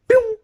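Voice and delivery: high, loud "pew"; imitated whoosh of sudden speed; quick squeaky launch noise; zooming sound effect.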